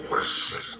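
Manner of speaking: voiceless car swoosh; whooshing skid effect; whoosh noise. Acted. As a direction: make a breath-only rushing sound. Imitates a car pulling up.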